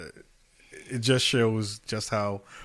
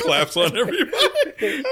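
laughing; 13 semitones higher than usual. Real, not acted. Laughing while talking.

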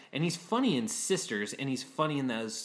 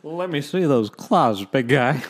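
louder, slow leering call; creepy Herbert voice; 11 dB louder, slower than usual. Pretend. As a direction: leer at him, slow and loud.

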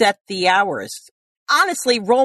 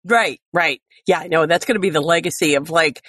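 ordinary delivery

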